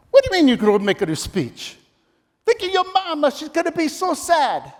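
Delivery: guilt-trip him like an Italian dad; Italian dad accent. Retold or imitated. Dad pushing son to speak.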